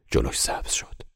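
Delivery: ordinary delivery, nothing marked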